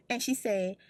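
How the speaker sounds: ordinary delivery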